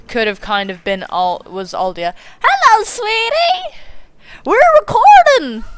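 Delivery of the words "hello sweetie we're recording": high, slow, sing-song hello to a loved one; high, slow cooing greeting to the partner who just got home; sweet greeting. Real, not acted. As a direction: coo it like greeting your partner coming home.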